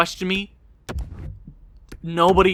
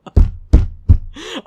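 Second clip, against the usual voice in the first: pained laugh; 8 semitones higher, 5 dB louder than usual. Real, not acted. Laughing at own blunder.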